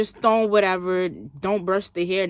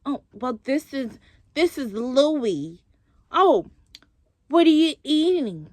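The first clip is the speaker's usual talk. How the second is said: mock snooty bragging; 4 semitones higher, slower than usual. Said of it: "it was just always like".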